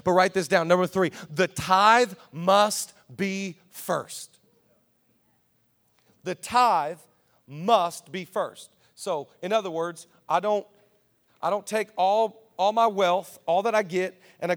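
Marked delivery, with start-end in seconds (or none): 0.7-8.7 slow, deliberate, every word weighted; slow dictation. Shown as a demonstration. Pastor dictating a point for notes, slow. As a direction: say it slowly so they can write. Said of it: "You write down point number three".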